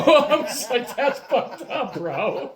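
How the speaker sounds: high, slow, laughing through the words; laughing protest. Retold or imitated. To brother's joke about broken thumbs, laughing. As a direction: crack up while protesting.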